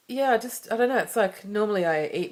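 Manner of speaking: a mother tearing up while telling of guilt, emotional; real welling emotion. Real, not acted. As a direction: voice lifting, choked up. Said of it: "I'm getting emotional now as well".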